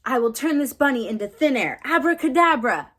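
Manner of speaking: loud, high-pitched magic-act announcement; stage magician. Acted. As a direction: proclaim the spell to the audience like a magician. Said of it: "magicians say it after a magic act".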